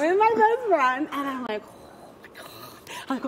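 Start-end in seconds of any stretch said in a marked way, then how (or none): 1.6-3.0 barely audible, slow whispered swoon; awed whisper. Retold or imitated. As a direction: whisper it to your friend in awe. Retold awed reaction to hot guy.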